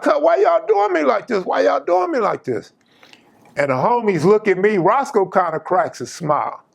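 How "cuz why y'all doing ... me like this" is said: high-pitched mock wail; whiny retold complaint, pitched way up; imitated plaintive protest. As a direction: plead in a much higher voice, imitating the victim. Imitating cousin gasping back, pleading.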